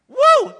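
high, loud whoop of joy; a loud, high cheer of joy; joyful woo. Retold or imitated. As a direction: whoop like you just won. Peter on cloud nine.

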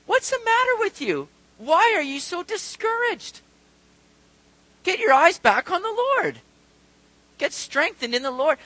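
high, insistent self-rallying; exasperated order to oneself; scolding himself. Acted. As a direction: talk yourself round, pitched high and emphatic. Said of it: "He's talking to himself saying".

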